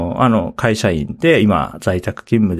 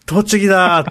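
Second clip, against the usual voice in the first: excited; 9 semitones higher than usual. Retold or imitated. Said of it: "テンション上がりましたね".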